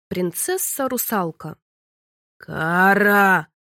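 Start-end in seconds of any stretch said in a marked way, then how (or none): 2.4-3.5 loud booming call of her name. A narrator's reading. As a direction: roar her name across the water. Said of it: "громыхнуло неподалёку". (громогласно позвать: «Кара!»)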